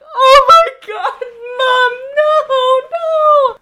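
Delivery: high, loud mock crying plea; a terrified little kid begging mom; panicked child. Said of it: "And I was like".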